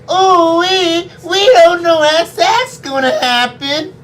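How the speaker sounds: ordinary talk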